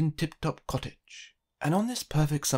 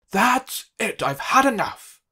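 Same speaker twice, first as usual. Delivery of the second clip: higher, louder exasperated cry; angry outburst; 7 semitones higher, 8 dB louder, slower than usual. A narrator's reading. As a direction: snap at the host, fed up. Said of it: "cried Mister Uppity suddenly".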